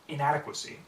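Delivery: ordinary delivery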